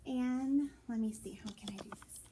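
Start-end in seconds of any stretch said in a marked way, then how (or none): 1.4-2.2 whisper. Real, not acted. Muttered to self.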